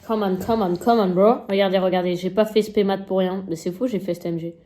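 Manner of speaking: ordinary talk, nothing marked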